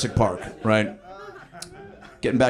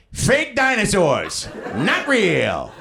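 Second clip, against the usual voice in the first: grandma heckling; 9 semitones higher, 3 dB louder, much slower than usual. Retold or imitated. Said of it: "Which was followed by".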